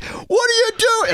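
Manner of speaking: very high squawked yell; road-rage outburst. Retold or imitated. Driver yelling at someone who cut him off, furious high screech. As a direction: explode at the other driver, pitch shooting up. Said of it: "some idiot, like, cuts me off".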